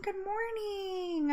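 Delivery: high, soft, slow "good morning" falling away; drawn-out falling greeting. Real, not acted. Answering the chat again, slow soft falling good morning. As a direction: stretch "good morning" slowly and let it fall softly.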